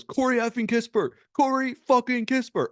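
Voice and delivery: high-pitched imitation of a yelling coach; imitating coach's yell. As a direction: holler it like an exasperated coach, hammed up. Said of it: "Tom Thibodeau yells on the sideline".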